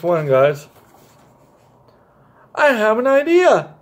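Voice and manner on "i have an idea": calling out higher with a mild raise; a soldier blurting out his idea; sudden eager shout of an idea; excited shout. Acted. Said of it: "shouted the first lead soldier".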